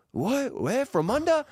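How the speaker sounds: put-on high puzzled accented voice; confused taxi guy. Taxi dispatcher puzzled by the fake place, high and baffled. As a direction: act baffled, pitch way up. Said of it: "the guy's like".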